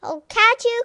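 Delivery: high, loud chasing call; cried out. Acted. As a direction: cry out as you run after the dog. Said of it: "cried Neelie".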